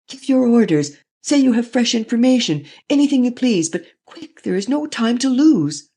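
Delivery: frantic high-pitched appeal for quick action; urgent pleading. A narrator's reading. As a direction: press the Premier desperately. Lupin urgently pleading to Premier.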